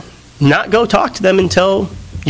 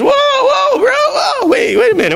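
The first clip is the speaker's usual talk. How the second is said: mock alarm, pitched way up; alarmed interruption. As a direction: imitate a friend hurriedly calling a halt. Friend interrupts his rising anger.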